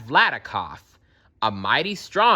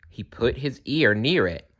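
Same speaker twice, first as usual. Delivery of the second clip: plain reading voice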